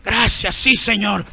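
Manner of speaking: low, hushed, awed voice of a humble listener; a touched churchgoer, gently acted; humble believer moved by the word. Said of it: "el orgulloso no dice".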